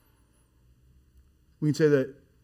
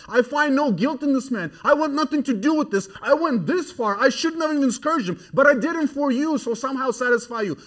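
exasperated refusal; 10 semitones higher, 6 dB louder than usual. Retold or imitated. Pilate refusing the crowd.

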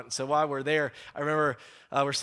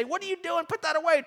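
much higher-pitched grandma voice; scolding grandma; 13 semitones higher than usual. Acted. Grandma refusing the teenager's money, high mock scolding. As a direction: put on a high voice for a disapproving grandma. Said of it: "She would say".

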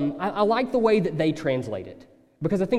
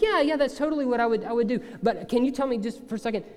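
higher, fast, eager voice of a listener who thinks he knows; mock-casual imagined listener, higher and quick; breezy know-it-all listener; 6 semitones higher, faster than usual. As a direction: rattle it off, then trip into a confused question. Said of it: "you might be like".

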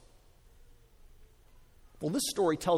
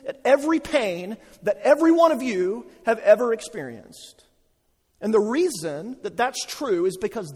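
rising, louder impassioned preaching; fervent pulpit proclamation of God's care; preaching at full force; 7 semitones higher, 5 dB louder than usual. Acted. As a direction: build the sermon to a passionate peak.

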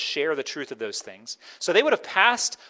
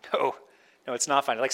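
ordinary talk, nothing marked

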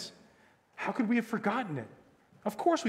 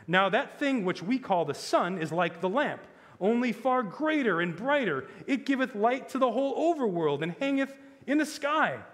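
higher, formally emphatic explanation; cold politeness; 7 semitones higher, 6 dB louder than usual. A narrator's reading. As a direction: explain it with pointed courtesy. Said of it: "very coldly and politely".

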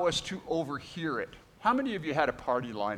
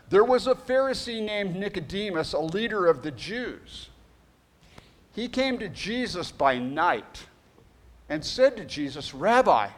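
louder, higher preacher's reading of the Gospel; scripture reading; 5 semitones higher, 4 dB louder than usual. Acted. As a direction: proclaim the passage to the congregation. Reads scripture aloud.

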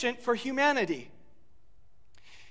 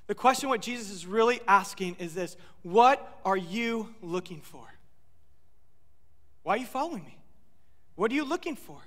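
ordinary talk, nothing marked

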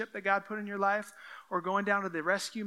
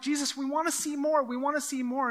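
higher, pressing demand; eager crowd pushing for more; pushy crowd; 6 semitones higher than usual. Acted. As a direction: plead insistently, pitch raised. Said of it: "So they're pushing in".

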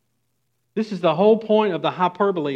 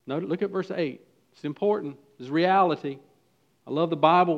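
normal talking voice

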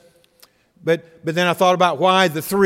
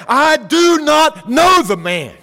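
much higher, louder, slow angry shout; cursing denial; 11 semitones higher, 8 dB louder, slower than usual. Retold or imitated. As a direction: fling the denial out like a curse. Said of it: "began to curse and to swear".

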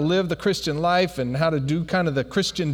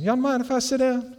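high-pitched, forward friend; pitched way up, inviting oneself in; Skin; 7 semitones higher than usual. Retold or imitated. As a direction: ask in a high, cheeky voice. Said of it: "Y'all gotta know Skin".

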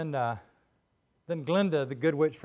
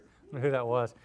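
ordinary delivery

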